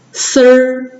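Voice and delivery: 丝儿 as one syllable "ser", i dropped; erhua demo. Shown as a demonstration. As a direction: hold 丝儿 level with r-coloured vowel. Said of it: "一都不读了".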